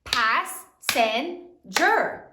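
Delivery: very slow, each syllable drawn out. Shown as a demonstration. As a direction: stretch Passenger into its three syllables. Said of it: "How many syllables are there in passenger?".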